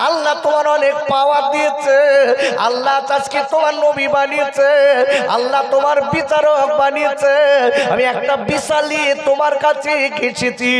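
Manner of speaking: chanted sermon storytelling; sing-song preacher's waz, full voice; rhythmic, stretched-out sermon chant; preaching. Acted.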